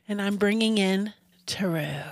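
hushed, mysterious reveal; whispering. Acted. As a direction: whisper it like a secret. Announcing the new topic.

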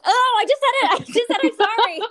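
high, loud laughing outburst; laughing exclamation. Real, not acted. Woman catches herself saying sorry, laughing exclamation. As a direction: burst out laughing as you catch yourself.

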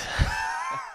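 sudden high squealing laugh; shrieking laugh. Real, not acted. Guest cracking up at his own punchline, squealing laugh. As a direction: let a piercing laugh burst out.

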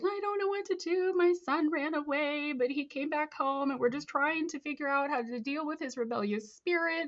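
high-pitched worried mother imitation; pleading mom. Mom calling a counselor about her runaway son, pleading. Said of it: "moms would call in and be like".

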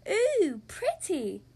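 high, loud amazed ooh; delighted ooh. Acted. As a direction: gasp in wonder at it. Peppa admires lit pumpkin.

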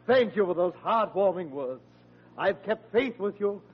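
normal talking voice

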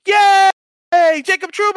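high, loud cheer of the name; mock cheering crowd. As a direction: cheer him like a happy home crowd. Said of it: "they were like".

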